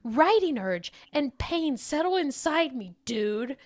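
put-on loud, over-the-top dude accent; shouting capitalized words. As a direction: shout it like an all-caps surfer review. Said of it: "gonna read it in all caps... put a little accent on it".

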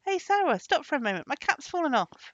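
shouted plea to a servant; crying out. A narrator's reading. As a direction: call out for someone to stop. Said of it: "he cried".

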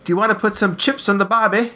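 a hammy stock Australian bloke. Pretend. Mock Aussie greeting to listeners.

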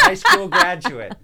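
slow, high, laughing it out; cracking up on the words. Real, not acted. Laughing at his own qualifications.

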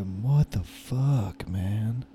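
slow, drawn-out shocked swear; stunned disbelief. Retold or imitated. Retelling his reaction to the boss's news, slow and stunned. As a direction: let the shock stretch every word out.

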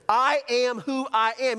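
high, loud preaching proclamation; God's declaration. Acted. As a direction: proclaim it with full conviction. Preacher speaks as God to Moses.

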